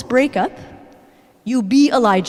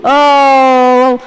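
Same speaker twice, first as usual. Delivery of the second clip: mock panicked wail; 9 dB louder than usual. Mimics Elijah's dramatic panic.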